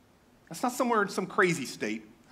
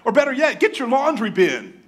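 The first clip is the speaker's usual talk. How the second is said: louder, higher, eager mock tip; excited hoarder; 8 semitones higher, 7 dB louder than usual. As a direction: hype up the laundry-bin idea. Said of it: "People got creative".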